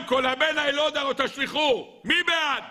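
high, ringing proposal to a council; proclaiming adviser. Acted. Balaam putting the decree to the cabinet, proclaimed. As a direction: declare it high, then call for a vote.

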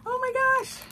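thrilled squeal. Real, not acted. Unboxing her first doll, thrilled high squeal. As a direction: squeal it with pure delight.

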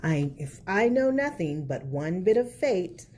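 put-on lilting picture-book narration for kids; reading to children. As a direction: read it like storytime at the library.

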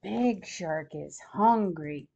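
plain reading voice

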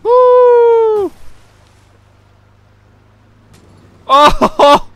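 loud high whoop; excited whoop. Real, not acted. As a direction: whoop with glee. Wild boat ride.

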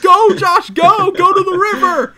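very high, very loud yelling; urgent shout. Retold or imitated. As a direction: scream at him to run. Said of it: "and he's like".

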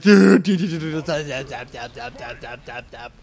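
2 imitating electric zaps, fading to a murmur; 3 buzz out the zaps like an enemy attack; 1 mock zapping noises. 4 mocking the enemies' electric spam, zapping sounds. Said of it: "be like".

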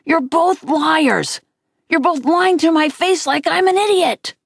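high, furious accusation; child's outburst, pitch pushed up; angry screaming. A narrator's reading. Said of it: "I screamed at Via".

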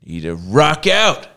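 high-pitched, hammed-up righteous outburst; mock indignant rant. Imagining himself protesting a broken system, mock indignant high rant.

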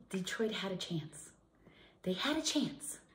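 rueful whisper; disappointed fan's whisper. Real, not acted. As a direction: whisper it like a sad fan. Whispered lament over Detroit loss.

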